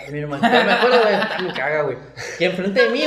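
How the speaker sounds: real laughter breaking through the words; talking and chuckling at once; laughing while talking. Real, not acted. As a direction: laugh through the story as you tell it. Laughing while telling the story.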